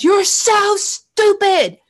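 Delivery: high, drawn-out angry yell; loud mock-abusive shout; yelled insult. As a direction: yell it at someone, stretching each word. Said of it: "somebody yells at you and says".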